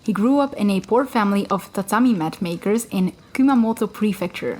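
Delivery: ordinary delivery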